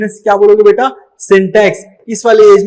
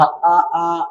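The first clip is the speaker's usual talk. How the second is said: put-on baby babble; 3 semitones lower, 4 dB quieter, much slower than usual. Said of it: "बच्चा जो कि words pronounce नहीं कर पाता था".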